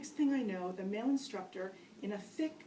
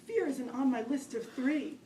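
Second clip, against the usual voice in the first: panicked inner voice; 4 semitones higher, 6 dB louder than usual. Retold or imitated.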